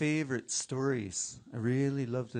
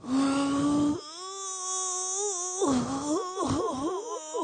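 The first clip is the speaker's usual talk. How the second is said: long, high groan of effort; acted all-out shoving groan; straining push; 5 dB louder than usual. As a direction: heave with a drawn-out rising wail. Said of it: "sounded like when they pushed".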